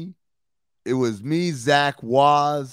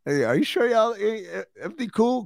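much higher, hesitant mock concern; retold worried question; 11 semitones higher than usual. Audience member asks if the acrobats are safe, high worried question. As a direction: stammer out a high-pitched check.

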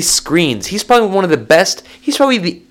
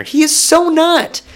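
much higher, slow, sassy protest; indignant denial; 13 semitones higher, slower than usual. Real, not acted. To fans who doubt Adams, a high sassy denial. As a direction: scoff the idea away, drawn out.

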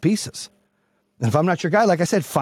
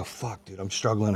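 muttered to himself, much softer; quiet self-talk; 6 semitones lower, 9 dB quieter than usual. Retold or imitated. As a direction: drop to a private under-the-breath admission. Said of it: "I have to go".

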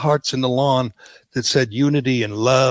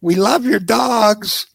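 mock adoring reporter; 6 semitones higher, much slower than usual. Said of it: "another reporter says".

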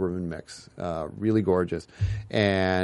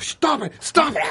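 high, shrill-free panicked stop it; a panicked friend yelling at the dog; frantic Ross; 4 dB louder than usual. Retold or imitated. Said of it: "he's Scottish. So he's like".

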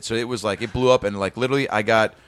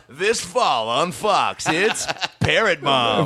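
slow, raised announcer voice; mock TV promo; 4 semitones higher, much slower than usual. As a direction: announce a silly new sitcom, stretched out.

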